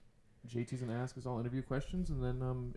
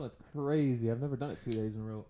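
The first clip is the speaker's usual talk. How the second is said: ordinary talk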